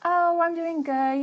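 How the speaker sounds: high, drawn-out breezy pretence; mock polite fine. The polite answer she avoided giving, in slow high-pitched fake ease. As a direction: pretend all is well, slowly. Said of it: "instead of being like".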